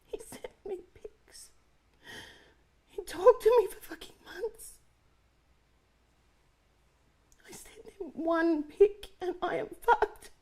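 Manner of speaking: choked tearful whisper rising to a sob; crying. Acted. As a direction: cry it out through panic. Panic over leaked pic.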